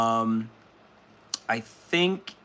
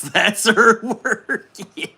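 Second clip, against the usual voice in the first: slow, higher, louder through laughter; laughing; 8 semitones higher, 6 dB louder, slower than usual. Real, not acted. Reading a chat joke about the worm, laughing. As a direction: laugh the words out.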